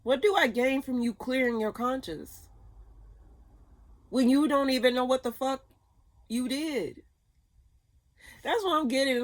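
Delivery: high, loud, frustrated rant. Real, not acted. Tarot reader venting about a selfish person, loud angry rant. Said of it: "Why am I ranting?".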